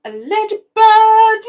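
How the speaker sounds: high, loud, slow ladybird; excited reveal. Acted. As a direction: show it off with a big delighted squeal.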